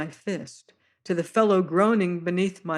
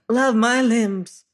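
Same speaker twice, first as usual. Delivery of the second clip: higher, slow, louder fat sailor voice; a plump sailor cooing high and drawn out; high fluting voice of a fat seaman; high-pitched voice; 5 semitones higher, 7 dB louder, slower than usual. Acted. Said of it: "says he in soft, high-pitched voice".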